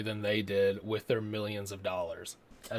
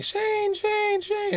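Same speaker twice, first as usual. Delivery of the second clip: much higher mock scream of his name; screaming wife; much slower than usual. Reviewer voicing wife being mugged, high mock scream. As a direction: shriek the name in panic, hammed up. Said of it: "she's screaming".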